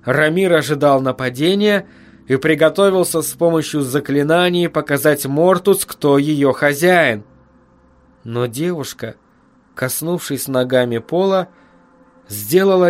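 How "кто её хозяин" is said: ordinary delivery, nothing marked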